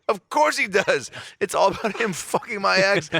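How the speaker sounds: high-pitched, indignant disbelief; comic exasperated cry; exasperated outburst. Retold or imitated. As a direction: blurt it out high, like it is painfully obvious. Acted outburst at hearing the news.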